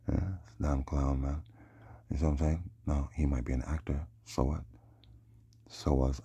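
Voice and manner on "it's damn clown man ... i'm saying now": ordinary delivery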